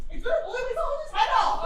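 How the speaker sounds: the line gonna blow Mister Hodges' head off cried out high, laughing; shouted with a laugh. Acted. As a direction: shout it again, half laughing. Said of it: "repeat louder".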